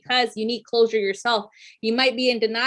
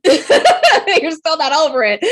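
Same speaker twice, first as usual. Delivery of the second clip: louder, slow, laughing through the words; 7 semitones higher, 10 dB louder, slower than usual. Real, not acted. Reader teasing the listener about an old grudge, laughing loudly. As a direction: laugh as you tease them.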